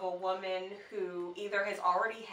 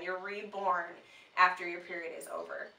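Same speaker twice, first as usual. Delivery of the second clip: normal talking voice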